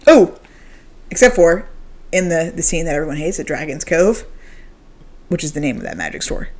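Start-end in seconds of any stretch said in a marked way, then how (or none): 5.3-6.5 hushed aside; whisper. Real, not acted. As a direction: drop to a secret whisper. Whispered aside on store name.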